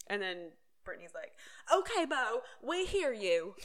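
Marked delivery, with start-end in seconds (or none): 1.7-3.5 mock retort. Mimics Brittany's irritated retort.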